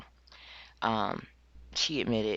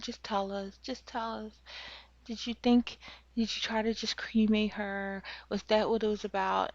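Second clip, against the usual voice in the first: coaxing officer; 10 semitones higher than usual. Retold or imitated. Officer coaxing Brooke to confess, high and coaxing. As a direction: gently wheedle a confession out of her. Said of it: "the female officer is holding her hand, comforting her".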